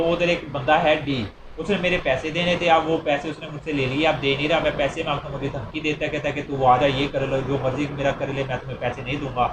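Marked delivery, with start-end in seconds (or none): none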